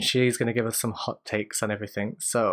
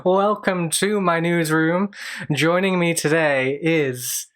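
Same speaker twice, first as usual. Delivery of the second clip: news anchor voice; 4 semitones higher, 4 dB louder than usual. Acted. Host opens mock news show.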